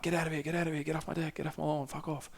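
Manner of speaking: hushed, high-pitched imitation of yelling; mock yelling. Locals turning away a stranger at the door, mock yelling. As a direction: mimic distant angry householders, small and high. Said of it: "People are yelling at him".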